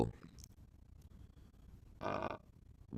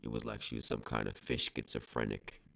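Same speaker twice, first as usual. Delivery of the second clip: ordinary delivery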